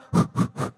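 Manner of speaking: short, forceful puffs of air; quick breaths out. Shown as a demonstration. Speaker leading the group in breathing, quick audible exhales. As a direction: blow out quick deep breaths. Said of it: "Three quick deep breaths out".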